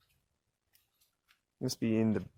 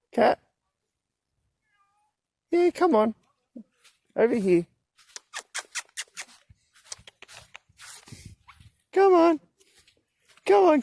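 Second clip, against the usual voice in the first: high, loud coaxing calls; high calling voice; 4 dB louder than usual. Real, not acted. Coaxing the cat across the park, high loud calls.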